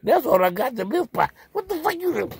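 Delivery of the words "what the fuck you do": shrill jump up in pitch; sudden high startled cry; startled yelp. Acted. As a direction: yelp like you just got grabbed. Yelps at being squeezed.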